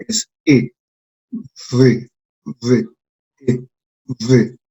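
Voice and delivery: E said between Spanish I and E, then Z buzzed; modeling sounds. Shown as a demonstration. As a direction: sound out E and Z one by one, slowly. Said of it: "un sonido que está entre la I y la E".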